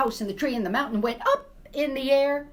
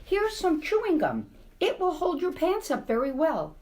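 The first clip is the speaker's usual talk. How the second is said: put-on helpful children; 8 semitones higher than usual. Children offer help.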